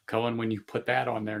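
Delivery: usual speaking voice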